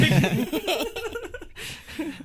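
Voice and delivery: loud, high-pitched laugh; laughter. Real, not acted. Cracks up at his own confession.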